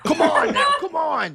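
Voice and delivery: high laughing shout of come on. Real, not acted. Keeper hurrying the players along, laughing loud shout.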